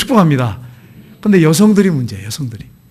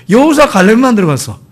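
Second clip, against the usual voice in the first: usual speaking voice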